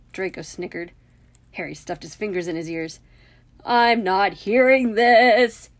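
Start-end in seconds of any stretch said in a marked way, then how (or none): 3.6-5.7 slow, loud, raised-pitch protest; loud refusal to hear. A narrator's reading. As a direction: drown it out, word by word. Fingers stuffed in his ears.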